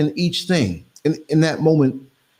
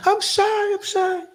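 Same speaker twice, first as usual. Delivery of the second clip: put-on much higher, bashful girlish voice; shy young lady; slower than usual. Retelling how the young woman shyly refused to film, in a high bashful voice. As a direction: play the shy stranger, coy and bashful.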